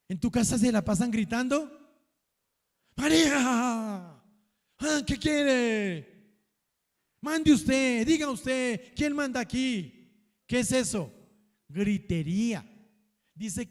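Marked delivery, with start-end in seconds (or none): 3.0-10.1 raised, bossy voice calling María; mock household yelling. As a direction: shout it like a bossy head of house. (imitando gritos en casa, más alto y mandón)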